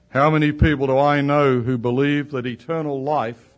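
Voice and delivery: usual speaking voice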